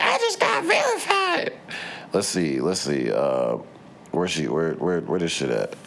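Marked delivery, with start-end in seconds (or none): 0.0-1.5 very high mocking little-girl voice; squeaky bratty kid bragging, imitated; high mock child voice flexing her clothes.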